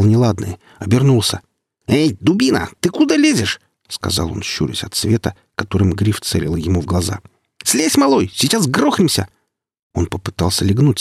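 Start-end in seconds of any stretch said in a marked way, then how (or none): 1.9-3.6 startled man on the ladder yelling down; alarmed shout. Acted. Kalina shouts at climber. 7.6-9.3 frightened shout as the ladder wobbles; alarmed yelling. Acted. Ladder about to fall.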